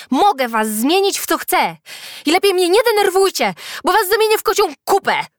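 high, loud, angry threat; furious shouting. A narrator's reading. Enraged Julka threatens her classmates, shouting. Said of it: "Teraz Julka naprawdę się rozzłościła".

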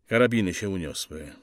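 ordinary delivery

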